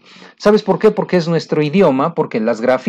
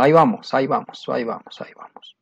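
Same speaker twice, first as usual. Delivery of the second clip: fading to a whisper; 3 semitones lower, 5 dB quieter, faster than usual. Real, not acted. Teacher fading out playfully.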